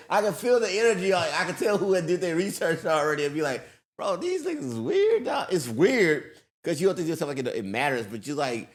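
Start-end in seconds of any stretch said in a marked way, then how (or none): 4.0-5.5 high-pitched, baffled aside; mock bewilderment. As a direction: replay it in a higher, weirded-out voice. Voicing his reaction to parents.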